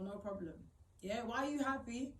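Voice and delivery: ordinary delivery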